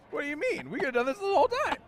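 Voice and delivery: high, fast, loud outburst; excited disbelief. Real, not acted. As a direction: burst out in amazed disbelief. Disbelieving outburst at the easy fix.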